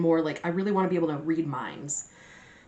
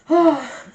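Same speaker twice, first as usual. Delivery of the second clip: loud satisfied sigh; big contented exhale; 9 semitones higher, 8 dB louder than usual. Real, not acted. Loud sigh after eating.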